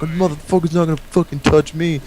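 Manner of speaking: mock the hothead's bravado; tough guy quote. Voicing tough friend.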